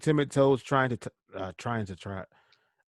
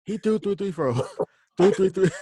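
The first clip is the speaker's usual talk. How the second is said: high mocking; 8 semitones higher than usual. Mock high repetition.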